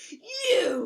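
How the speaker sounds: very high, loud accusing screech. Acted. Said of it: "he screeched".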